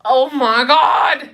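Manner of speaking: loud, high, drawn-out groan of words; mock exasperation. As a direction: stretch it out, exasperated. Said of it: "and then I get irritated".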